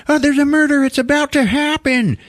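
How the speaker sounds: very high, frantic caller voice; mock panic. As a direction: act out a hysterical emergency call. Panicked emergency call, retold.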